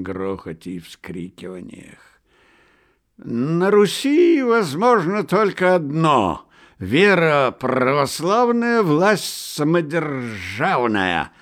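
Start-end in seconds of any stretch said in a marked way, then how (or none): 3.2-11.3 slow, high, loud proclamation; drunken shout. A narrator's reading. Myshlaevsky drunk at the table, slow loud shouting. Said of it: "кричал Мышлаевский".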